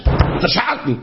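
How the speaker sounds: imitating a passionate shouter. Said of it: "er schreit es so mit, mit Passion".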